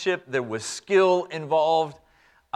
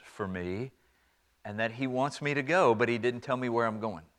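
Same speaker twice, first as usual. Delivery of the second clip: soft earnest son; 8 semitones lower, 10 dB quieter than usual. Acted. Abraham explaining God's call.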